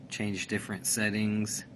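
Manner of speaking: normal talking voice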